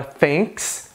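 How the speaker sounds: "thanks" with a full TH, American English; American accent. Shown as a demonstration. Teacher contrasting the TH, American accent. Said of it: "no inglês indiano".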